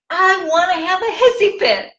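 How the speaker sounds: put-on high-pitched childlike tantrum whine; mock hissy fit. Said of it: "I literally had a hissy fit".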